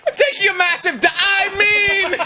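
high, loud panicked blurting; comic yell that catches itself; flustered shouting through the door; super frantic. Acted. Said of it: "Super frantic".

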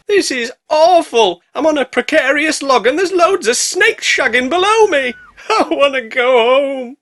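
high, loud, slow whiny wail; mock panic. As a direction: wail in hammed-up terror. Girl panicking on log.

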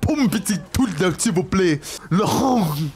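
high-pitched gibberish French tirade; mock French cursing. Showing how elegant cursing in France sounds, mock French cursing. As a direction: rattle off fake French swearing. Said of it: "when you curse in France".

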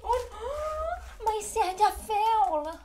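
high, slow gasping alarm; dismayed gasp. Real, not acted. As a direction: gasp as the chocolate Santa topples. Chocolate Santa falls over.

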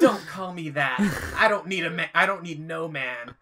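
sassy put-on Roz rasp. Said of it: "She turned into Roz".

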